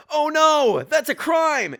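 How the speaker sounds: high and loud, hammed-up shock; mock alarm. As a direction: gasp it out in fake horror.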